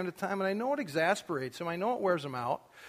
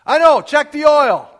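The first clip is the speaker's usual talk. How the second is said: put-on high and loud, teenage call down the hallway; retold yell; 10 semitones higher, 18 dB louder than usual. Teenager yelling to his dad on the way out, imitated yell. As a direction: yell it back before he can say it. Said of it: "I would now yell".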